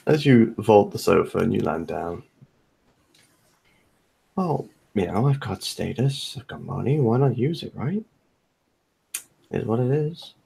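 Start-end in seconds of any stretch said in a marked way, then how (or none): 4.3-10.3 soft, lilting shrug about having money; lightly voiced casual host. Host showing off the house to a guest, casual and soft. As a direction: play a lightly voiced, easygoing rich host.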